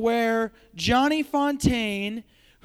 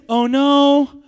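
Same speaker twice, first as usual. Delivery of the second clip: mock dismay; 4 semitones higher, 8 dB louder than usual. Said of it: "some of you are saying".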